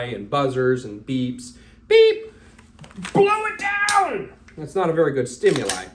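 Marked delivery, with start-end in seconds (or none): 1.9-2.3 high, loud imitated beep; mock timer beep. Coach mimicking the range timer, a high loud beep. 3.1-4.3 high, slow, hammed-up shout; mock command. Coach parodying a shooter who fires on the beep, hammed-up shout.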